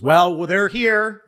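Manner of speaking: a host milking the big moment, slowly and loudly; Howie Mandel. Retold or imitated. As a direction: draw it out like a game show host springing a surprise. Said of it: "Howie Mandel goes".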